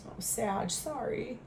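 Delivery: barely audible, emotion catching the voice; fighting back tears, soft; choked up. Real, not acted. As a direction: voice catching on real sadness. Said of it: "really don't normally get emotional".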